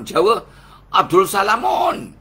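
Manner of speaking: loud, high, slow shout of the name; joking shout of a Javanese-style name; playful shout. Acted. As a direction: belt the name out as a punchline. Punchline of the Javanese name joke.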